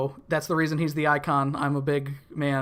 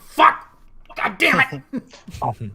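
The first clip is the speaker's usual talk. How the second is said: loud, slow mock curse; frustrated outburst; 8 semitones higher, 7 dB louder, much slower than usual.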